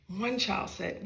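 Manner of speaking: ordinary delivery, nothing marked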